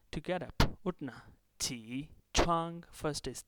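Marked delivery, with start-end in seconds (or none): 1.6-2.8 "qi" dips and rises, "chuang" high and level; tone demonstration. Shown as a demonstration. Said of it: "third tone and the second one".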